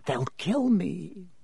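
slow, desperate begging; hushed, fearful croak; croaked plea. Acted. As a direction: beg weakly, dragging out the words. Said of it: "croaked Harry".

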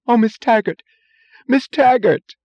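high, loud cry of relief and joy; joyous moan. A narrator's reading. Secretary greeting her lost boss in joyous moan. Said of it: "said, in a joyous moan".

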